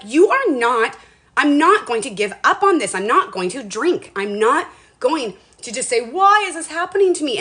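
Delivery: loud, firm inner vow, rising at the end; defiant self-talk. Retold or imitated. To herself while being tested, loud defiant vow. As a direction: tell yourself off out loud. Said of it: "I'm like".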